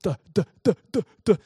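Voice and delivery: put-on a boy frozen on his reading turn, stammering; nervous stammer. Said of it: "So I'm like".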